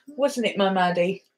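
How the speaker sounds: usual speaking voice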